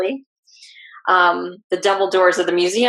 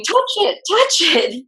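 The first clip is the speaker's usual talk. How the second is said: high-pitched urging, imitating screams without real volume; half-voiced imitation of clamouring voices; mock screaming; 11 semitones higher, slower than usual. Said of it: "all these voices are screaming".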